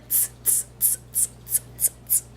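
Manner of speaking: soft whispered tss tss; whispered mocking tss sounds; monkey tsk-tsk. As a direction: tut back at him like monkeys. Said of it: "and said".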